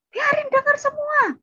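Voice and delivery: very high-pitched screech, imitating older sibling; defiant yelling. Older sibling wants everyone to hear, high defiant yell. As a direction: shout back, refusing to hush. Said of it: "Nggak usah teriak-teriak".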